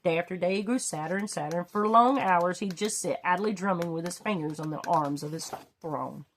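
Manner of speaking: ordinary delivery, nothing marked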